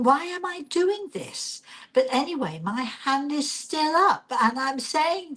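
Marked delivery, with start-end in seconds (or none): 0.0-1.6 high, bewildered aside to herself; baffled inner thought. Retold or imitated. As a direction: wonder at yourself, pitched up. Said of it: "And I'm thinking".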